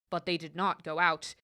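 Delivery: plain reading voice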